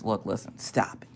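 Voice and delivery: very soft, whispered telling-off; hushed scolding. Pretend. Streamer telling off Whiny Crafts, hushed.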